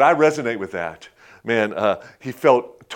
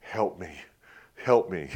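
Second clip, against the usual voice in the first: hushed, softer plea; quiet prayer; 5 semitones lower, 6 dB quieter than usual. Pretend. Husband praying for help in marriage, hushed. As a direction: pray it under your breath. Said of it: "pray that prayer".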